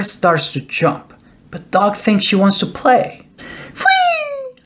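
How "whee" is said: very high whistled whee; high sliding whistle; star whistle. A narrator's reading. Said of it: "She whistles again".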